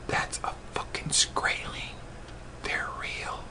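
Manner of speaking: hushed unvoiced whisper. Telling his wife in the hallway, whispered. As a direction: whisper so the couple won't hear.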